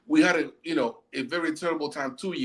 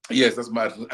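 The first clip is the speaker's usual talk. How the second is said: ordinary delivery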